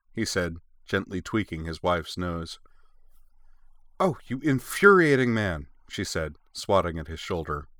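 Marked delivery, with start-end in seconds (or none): 4.0-5.7 higher, louder mock outrage; playfully exasperated. A narrator's reading. Wife swatting her teasing husband, mock-exasperated. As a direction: scold a teasing spouse, voice raised.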